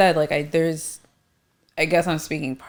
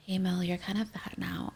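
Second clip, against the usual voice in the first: very soft imagined friendly remark; gentle, nice way; 13 dB quieter than usual. Pretend. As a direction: drop to a soft, gentle aside. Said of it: "in a nice way".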